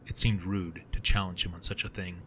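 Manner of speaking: usual speaking voice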